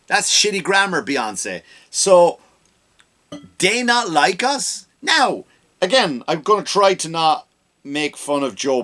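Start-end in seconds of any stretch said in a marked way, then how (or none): 3.6-4.9 mock imitation. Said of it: "she says about these".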